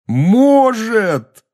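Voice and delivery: high, loud, emphatic exclamation. A narrator's reading. Harry answering his friend's disbelief, loudly. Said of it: "громко сказал Гарри".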